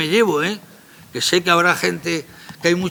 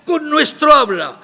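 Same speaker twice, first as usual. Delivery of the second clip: heated rebuttal; 6 semitones higher, 4 dB louder than usual. Real, not acted. Heated rebuttal about phonetics.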